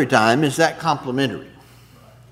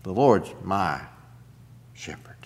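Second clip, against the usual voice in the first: hushed quote; 7 semitones lower, 4 dB quieter than usual. Real, not acted. Quoting the psalm softly.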